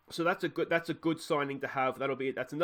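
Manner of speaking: ordinary talk, nothing marked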